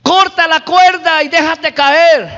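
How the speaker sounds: high, projected command from above. Retold or imitated. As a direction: call the order down to the climber. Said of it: "la voz le dice".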